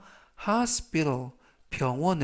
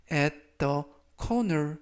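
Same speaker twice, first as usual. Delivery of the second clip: slow read; much slower than usual. Shown as a demonstration. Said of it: "slow teaching read".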